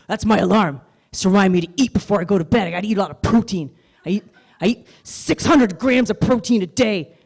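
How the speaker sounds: a loud, fast show-off muscle guy; boastful gym bro. Retold or imitated. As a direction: brag about protein like it's impressive. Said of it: "this is what he sounded like".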